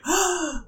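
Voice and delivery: sharp high gasp; crowd gasp. Acted. Crowd seeing the stabbed executioner, a sharp gasp. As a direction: gasp in shock like a whole crowd. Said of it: "like a gasp".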